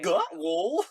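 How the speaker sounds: loud, high sock-mascot question; earnest woolly mammoth. Acted. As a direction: blurt it as the eager mammoth, rising at the end. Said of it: "a woolly mammoth earnestly asks".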